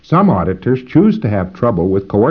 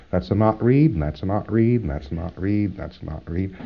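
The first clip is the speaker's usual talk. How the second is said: repeated calls fading much softer; calling not reads; 4 semitones lower, 8 dB quieter than usual. Shown as a demonstration. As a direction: call each not read, dropping away. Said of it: "Give me some not reads".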